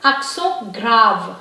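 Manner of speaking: accent grave as "ak-son grahv"; French nasal ending on accent, rolled-back r in grave; French pronunciation. Shown as a demonstration. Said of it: "this kind of accent is called".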